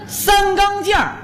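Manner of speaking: 三钢件儿 said high and slow with an er ending; mispronounced sangangjianr. Pretend. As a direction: drawl 三钢件儿 in a put-on voice. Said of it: "三钢件儿是什么呀".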